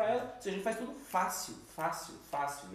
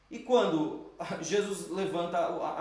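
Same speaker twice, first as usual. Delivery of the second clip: ordinary talk, nothing marked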